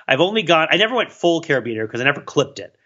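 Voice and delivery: normal talking voice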